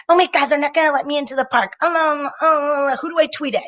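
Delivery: high, fast mock panic; hammed-up high frantic whine; panicky entitled guy. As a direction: flutter like an entitled guy locked out.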